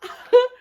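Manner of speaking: short, high, loud laugh; real high-pitched giggle. Real, not acted. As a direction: burst out with a quick amused laugh. Reader amused by the story.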